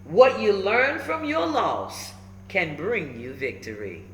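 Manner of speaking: ordinary delivery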